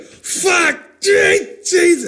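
high, separate yelps of swearing; mock pained cries. Patient during the procedure, high pained outbursts. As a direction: yelp each word as if jabbed.

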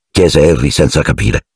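plain reading voice

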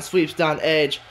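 normal talking voice

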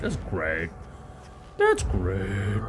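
put-on deep sarcastic voice; mock approval. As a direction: drop it low, mock-approving.

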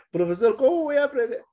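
high, fast mock student panic; imitated student squealing in panic; panicked student. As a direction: whine like a pupil who's overwhelmed. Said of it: "student asking profesor".

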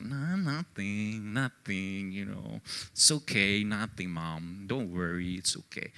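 hide the hurt, barely audible; sulky denial. Retold or imitated. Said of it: "And I said".

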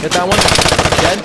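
loud urgent yell; shouted callout. Real, not acted. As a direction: bark the order over gunfire. Firefight callout.

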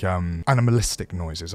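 ordinary delivery

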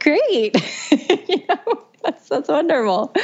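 lightly voiced husband, high and cheery; a hint of the husband humoring her; mock husband. Wife imitating her husband's cheerful reply, lightly voiced.

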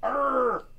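growled pirate "arr"; quick playful pirate arr. Pretend. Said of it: "Talk Like a Pirate Day".

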